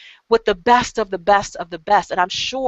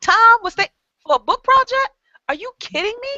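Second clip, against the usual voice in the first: higher, rising squeals of mock disbelief; mock amazement; 8 semitones higher, faster than usual. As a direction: hype up each perk in pretend shock. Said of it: "I'm saying".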